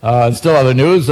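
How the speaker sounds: normal talking voice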